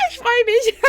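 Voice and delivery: high excited shout of joy; excited squeal. Real, not acted. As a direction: squeal with delight. Squeals joy about playing together.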